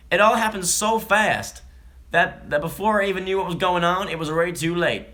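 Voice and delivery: a woman from Oregon quoted in an attempted Texan accent. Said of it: "So that's a Texan accent".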